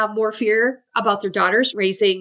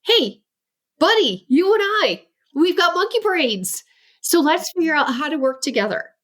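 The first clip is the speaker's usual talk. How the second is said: bright and higher, a parent rallying a buddy; cheerful encouraging parent; 5 semitones higher than usual. Retold or imitated. Parent rallying a child with ADHD, higher and cheerful. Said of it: "let's treat it like".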